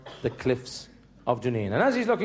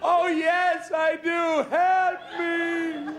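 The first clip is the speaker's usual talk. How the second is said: high, drawn-out wail for help; desperate pleading; much slower than usual. Acted. Man on a branch begs God, high desperate wail. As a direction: plead skyward in a long strained cry.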